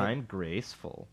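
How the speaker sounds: slow, self-satisfied smug voice; lightly put-on smug tone. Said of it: "my smug voice".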